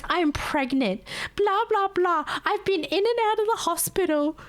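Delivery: mocking customer. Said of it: "she goes".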